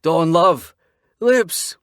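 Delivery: desperate man calling his lover, voice cracking; voice breaking shout. A narrator's reading. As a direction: cry out until the voice breaks. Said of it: "My voice breaks from how loud".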